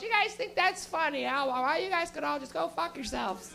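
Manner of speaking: put-on Canadian mom scolding the teenagers about the food. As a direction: scold the boys over the missing snacks. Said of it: "She goes".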